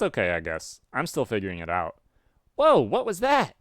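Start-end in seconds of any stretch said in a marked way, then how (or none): 2.6-3.5 high, loud startled character voice; startled friend in a put-on high voice; Garden Gnostic. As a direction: exclaim in alarm, pitched way up. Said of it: "I don't love this voice".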